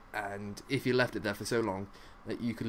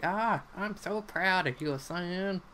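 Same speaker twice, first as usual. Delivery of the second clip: higher, slow voice of a proud aunt; doting aunt; 8 semitones higher, slower than usual. Retold or imitated. Long lost aunt praising at the parkour, in a mock doting voice. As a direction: coo it out like a doting relative. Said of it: "she's like".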